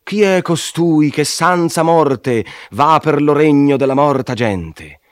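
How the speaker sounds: high, loud angry challenge; indignant, as if barring the gate; irritated demand. A narrator's reading. Fallen angels challenging the living intruder, angry. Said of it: "che stizzosamente dicean".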